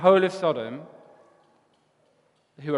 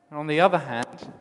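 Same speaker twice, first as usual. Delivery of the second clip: ordinary delivery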